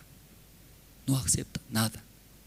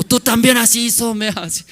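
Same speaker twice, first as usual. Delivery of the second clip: high, loud comeback; indignant retort; 11 semitones higher, 8 dB louder than usual. Acted. Husband wants to answer back to his wife, mock indignant. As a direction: throw the blame back, acted.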